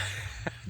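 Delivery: quiet breathy laugh; soft laugh. Real, not acted. Laughs at friend's drunk story.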